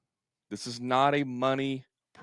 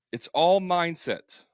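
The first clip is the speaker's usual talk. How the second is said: fired up; 7 semitones higher, 6 dB louder, slower than usual. Real, not acted. Fired-up rant, repeated slogan.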